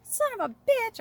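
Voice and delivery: high, loud mock curse; past angry self. Host imitating how she used to curse, high mock yell. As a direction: yelp it like the old hothead. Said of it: "I used to be like".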